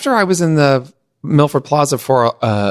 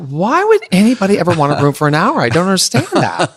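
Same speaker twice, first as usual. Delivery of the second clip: high incredulous question, laughing; naive disbelief; 8 semitones higher, faster than usual. Retold or imitated. Newcomer asks the office why rent hourly, incredulous. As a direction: play the wide-eyed newcomer.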